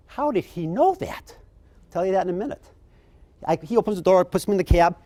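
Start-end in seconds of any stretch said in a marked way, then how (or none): 0.1-1.4 high, slow, wondering aside to himself; puzzled inner voice. Retold or imitated. Guest surprised by the bellman, a high slow wondering voice. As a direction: marvel at it under your breath. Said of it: "I'm going".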